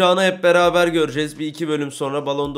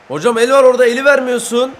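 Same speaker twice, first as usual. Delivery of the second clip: handball protest; 7 semitones higher, 6 dB louder, faster than usual. Real, not acted.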